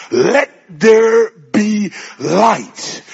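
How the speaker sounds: loud, very drawn-out proclamation; commanding shout. Acted. Preacher as God calling creation forth, commanding shout. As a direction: call it out slowly, word by word. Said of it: "clears his throat and he shouts".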